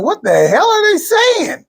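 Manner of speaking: high, slow, exasperated whine; mock confused listeners. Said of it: "People were like".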